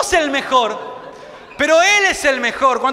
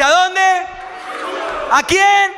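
call and response; 4 semitones higher, much slower than usual. Real, not acted. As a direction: lead the crowd to shout it back. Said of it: "Quiero que lo diga fuerte".

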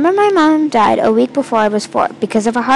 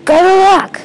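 loud, raised villain call; growly yell; 8 semitones higher, 7 dB louder than usual. A narrator's reading. Masked man taunting the chasers at the maze, growly yell. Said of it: "yelled in a growly voice".

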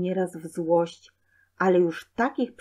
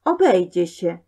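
louder, sulky refusal; a mild dismissive snub; sulky sneer; 6 dB louder than usual. A narrator's reading. Boy turns down his brother's offer, sulky. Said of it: "wykrzywił się Złośnicki".

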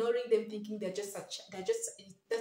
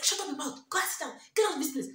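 put-on higher, louder, fast snapping at the child; angry dismissive shout; 7 semitones higher, 5 dB louder, faster than usual. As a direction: brush the kid off in a rush of irritation. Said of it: "you're like".

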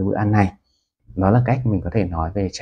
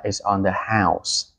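slow model phrase; much slower than usual. Shown as a demonstration. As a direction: say it like the restaurant treating you, slowly. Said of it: "nhà hàng mời thì nó sẽ nói là". (nhà hàng nói chậm rãi "It's on the house")

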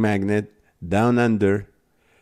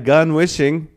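normal talking voice

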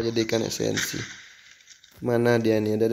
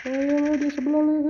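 much higher, urgent shout; urgent high callout. Real, not acted. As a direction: urge the team on.

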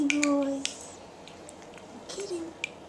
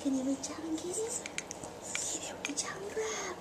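breathy whisper; 9 dB quieter, faster than usual. Real, not acted. Mom coaxing baby softly.

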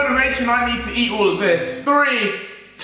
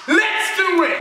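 hyped shout; 9 semitones higher, slower than usual. Real, not acted. Hyping up before spicy challenge.

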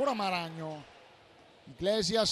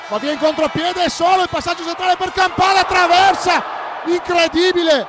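excited shouted goal call; breathless italian goal commentary; 2 semitones higher, 5 dB louder than usual. Real, not acted.

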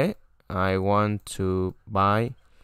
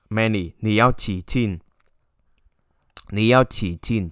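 ordinary talk

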